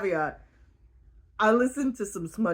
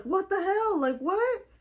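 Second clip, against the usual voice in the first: mock bewildered; 11 semitones higher than usual. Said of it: "And she's like".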